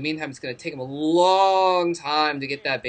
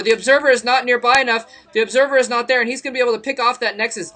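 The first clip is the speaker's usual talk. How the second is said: louder, higher rising hype; excited play-by-play; 8 semitones higher, 8 dB louder than usual. Real, not acted. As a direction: call it like the big moment. Caster hypes imminent Nexus kill.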